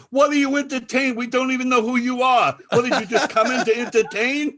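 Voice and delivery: high, loud mock outrage; scornful challenge. Party guest challenging the unknown entertainer, scornful shouting. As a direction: imitate an indignant heckling guest.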